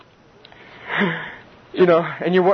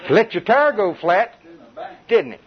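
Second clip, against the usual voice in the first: mocking devil; 6 semitones higher than usual. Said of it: "devil screaming".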